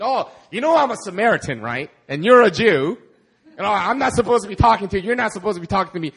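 half-laughing imitation of a prejudiced man; mock Samaritan. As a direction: play the hesitant bystander, amused.

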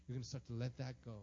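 normal talking voice

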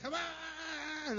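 high hammed-up wrestler shout; WWE wrestler. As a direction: pump up the crowd like a wrestler. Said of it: "like a W W E wrestler".

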